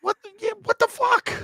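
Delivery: very high-pitched, squeaky disbelief; mock bewildered squeak; baffled outburst. Retells his bewildered outburst to chef.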